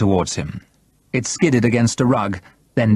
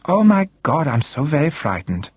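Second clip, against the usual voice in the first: high-pitched, sarcastic pretend fear; mock someone's threat with fake alarm; mock fright; 7 semitones higher than usual. A narrator's reading. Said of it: "Von Rellstab laughed".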